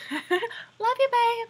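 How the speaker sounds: high, bright sing-song call; excited call-out. Real, not acted. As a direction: call out sweetly to him. Calling out to her boyfriend.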